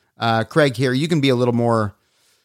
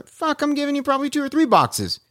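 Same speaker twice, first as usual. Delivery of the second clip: excited promise; 14 semitones higher than usual. Pretend. Imagining helping customer Craig.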